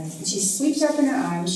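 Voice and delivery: usual speaking voice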